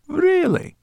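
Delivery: very high, loud "really"; grinning brownie. A narrator's reading. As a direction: squeal it with a teasing grin. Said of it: "Sorrel grinned".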